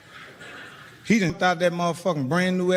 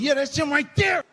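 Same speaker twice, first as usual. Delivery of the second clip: put-on kid shouting accusation; 8 semitones higher than usual. Nephew points him out.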